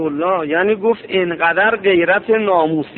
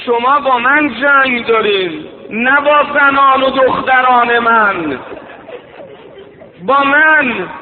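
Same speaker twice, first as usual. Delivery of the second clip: pitched up, ringing cry to the army; raised preaching lament; 4 semitones higher than usual. Acted. Preacher voicing the Imam's challenge to the enemy, raised lament.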